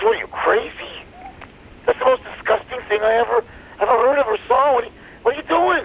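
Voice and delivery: high-pitched mock outrage; acted indignation, voice jumping way up; appalled disbelief. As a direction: protest in disgusted disbelief. Monkey recoils at elephant's offer.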